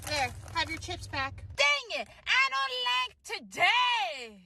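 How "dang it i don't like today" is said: high, slow whining wail; whiny kid tantrum. Acted. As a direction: drag out the sulky complaint like a spoiled child. Kid forced to give chips back.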